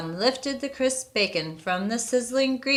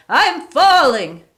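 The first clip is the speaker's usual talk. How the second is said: high, loud, slow bellowing warning; loud ghostly yell; 7 semitones higher, 10 dB louder, slower than usual. A narrator's reading. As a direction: yell it out strong, no longer weak. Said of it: "yelled the voice".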